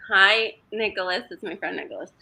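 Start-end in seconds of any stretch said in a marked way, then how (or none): none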